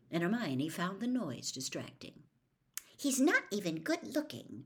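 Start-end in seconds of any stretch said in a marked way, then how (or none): 3.0-4.6 angry mutter. A narrator's reading. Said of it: "she muttered angrily".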